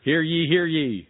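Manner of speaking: loud, slow, proclaiming hear ye; town crier. Pretend. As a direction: announce it like a herald.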